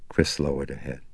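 usual speaking voice

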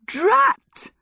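very high, loud exasperated cry; cried out. Acted. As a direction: spit it out mid-flight. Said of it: "she cried".

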